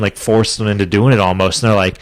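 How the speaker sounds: normal talking voice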